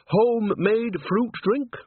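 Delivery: high, drawn-out offer; slow, falsely innocent hostess lilt; coaxing offer. A narrator's reading. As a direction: stretch it out high and inviting. Witch coyly offers doctored drink.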